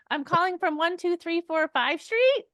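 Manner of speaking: Street rising up like a question; upspeak questioning. Shown as a demonstration. Coach mocking rep who rises on Street. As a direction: end on Street with a questioning rise. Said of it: "They're not going up at the end of their sentences".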